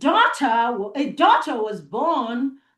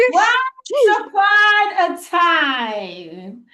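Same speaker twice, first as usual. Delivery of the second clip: sing-song story opening; 5 semitones higher, 4 dB louder, much slower than usual. Acted. Repeating story opening for children.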